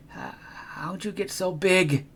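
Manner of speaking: stunned by the giant; gasped fear. Acted. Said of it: "I gasped".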